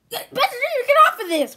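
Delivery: high, loud yell; alarmed order. Acted. Friend ordering the other off a site, alarmed order. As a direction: shout the friend away from it.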